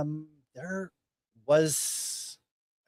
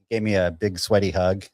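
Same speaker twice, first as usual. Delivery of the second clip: ordinary talk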